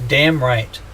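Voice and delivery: ordinary talk, nothing marked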